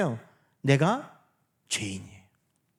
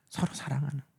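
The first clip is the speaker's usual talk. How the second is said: whisper; 6 semitones lower, 11 dB quieter, faster than usual. Real, not acted. Pastor whispering the answer to the congregation. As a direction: repeat it in a breathy hush.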